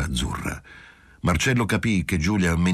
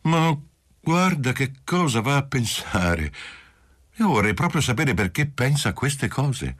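softly astonished; 8 semitones higher than usual. A narrator's reading. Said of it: "mollemente stupefatta".

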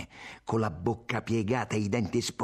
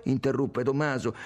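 plain reading voice

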